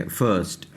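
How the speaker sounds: फर्स्ट with a neutral "ur" sound; zabar vowel. Shown as a demonstration. Teacher repeating the corrected word, फर्स्ट with "ur". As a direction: say फर्स्ट as "furst". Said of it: "फर्स्ट पढ़ते हैं".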